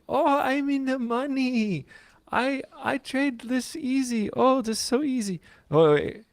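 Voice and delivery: high, slow mock Russian girl accent; mock hot girl Russian accent. Mocking a YouTube girl trader for the co-host, in a high Russian accent. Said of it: "a hot girl Russian accent".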